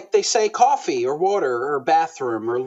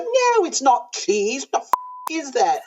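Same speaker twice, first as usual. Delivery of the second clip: mock camp director outrage; 9 semitones higher than usual. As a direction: play the horrified director, pitched way up. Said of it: "He's like".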